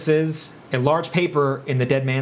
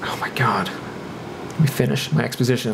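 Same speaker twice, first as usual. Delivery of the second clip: whispered exasperation; 5 semitones lower than usual. Real, not acted.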